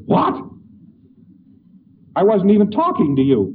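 hammed-up angry man startled into a rage. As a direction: snap back, offended. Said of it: "who's angry all the time says".

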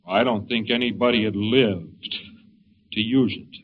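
low, slow, trailing reply; a tired old man musing; lightly voiced old man. Old man answering the salesman, low and slow.

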